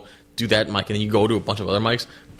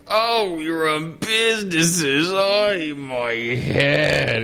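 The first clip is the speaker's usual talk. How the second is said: James Yukao; 6 semitones higher, much slower than usual. Retold or imitated. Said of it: "the most fucking nineteen thirties propaganda".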